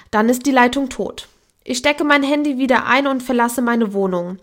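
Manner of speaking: ordinary delivery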